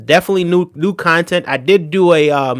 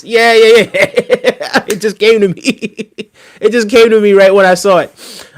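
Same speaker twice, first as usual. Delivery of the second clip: higher, louder, giggling delight; laughing recall; 4 semitones higher, 5 dB louder, slower than usual. Real, not acted. Streamer suddenly remembers the Yorkshire accent, laughing as he says it. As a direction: say it through real laughter.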